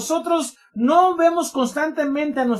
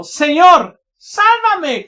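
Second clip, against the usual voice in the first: higher, much louder cry for rescue; gritando por auxilio; 7 semitones higher, 10 dB louder, much slower than usual. Acted. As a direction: cry out to the Lord as you sink. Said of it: "Dio voces diciendo". (grito de auxilio al Señor)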